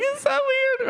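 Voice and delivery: very high mock-hysterical squeal; squeaky, tearful giggling voice, played for laughs; imitated laughing and crying. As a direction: imitate a wife helpless with giggles. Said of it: "she's just laughing and crying".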